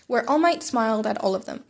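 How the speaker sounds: ordinary delivery, nothing marked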